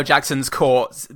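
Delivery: usual speaking voice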